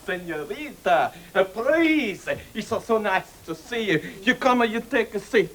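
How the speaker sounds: hammed-up Italian waiter welcome; mock Italian accent. Waiter greeting and seating a lone diner, mock Italian accent. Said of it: "the accent there".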